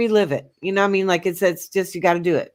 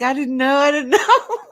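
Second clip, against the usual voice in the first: laughing; 6 semitones higher than usual. Retold or imitated. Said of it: "I was like".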